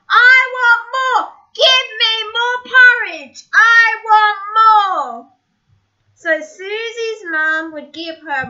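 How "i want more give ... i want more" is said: loud, high impatient yell. Acted. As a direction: shout it like a spoiled cub. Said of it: "start yelling once more".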